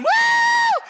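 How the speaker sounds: high whooping yell; whooping cheer. Retold or imitated. Crowd cheering streaker.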